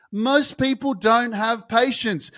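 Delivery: normal talking voice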